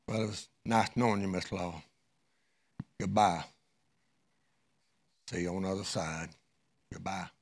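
very soft, low graveside goodbye; soft farewell. Acted. Husband bids the dead wife goodbye at her grave, very soft. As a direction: whisper a last goodbye at the grave.

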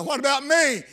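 high, pleading call of a prisoner; crying out. Retold or imitated. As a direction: call it out as an urgent appeal. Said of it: "he's crying out".

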